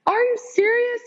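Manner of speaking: very high mock-sobbing squeak; retold sobbing disbelief. Caller learns he is joining the team, imitated sobbing.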